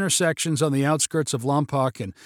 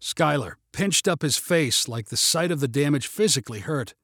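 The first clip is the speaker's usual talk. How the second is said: ordinary delivery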